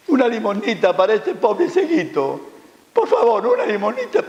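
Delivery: high-pitched beggar's plea; pleading blind beggar. Acted. Blind beggar asking passers-by for alms, high pleading. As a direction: beg in a high little voice for alms.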